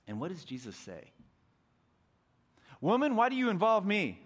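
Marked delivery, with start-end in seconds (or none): 2.8-4.2 loud, high, sharp brush-off; harsh rebuke. Pretend. Jesus rebuking his mother loudly. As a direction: snap it like a scolding son. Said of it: "maybe he didn't say it that way exactly".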